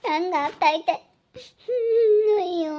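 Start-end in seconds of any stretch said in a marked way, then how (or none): 1.7-2.3 frightened whimper. Acted. Scared wife whimpers at night.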